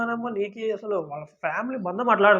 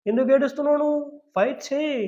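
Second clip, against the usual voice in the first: lightly imitated pep talk, pitched up; encouraging; 7 semitones higher than usual. Housemate spurring on a crying friend to fight, mock encouraging. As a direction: urge them on in a higher, retold voice. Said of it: "కొంచెం encourage".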